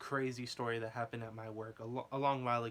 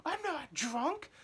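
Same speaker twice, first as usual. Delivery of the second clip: much higher, slow outraged squeal; indignant injured guy; much slower than usual. Retold or imitated. Snapping back at his buddy, mock high indignant squeal. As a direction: play the offended drunk denying it. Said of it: "the injured dude was like".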